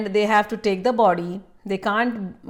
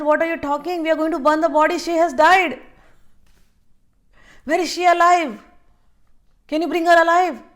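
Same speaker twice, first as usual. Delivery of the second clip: raised, high-pitched protest from a distraught father; grieving father's outburst; 9 semitones higher, 5 dB louder than usual. Retold or imitated. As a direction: cry out in disbelief, voice pitched way up and loud. Father angrily refuses Babaji.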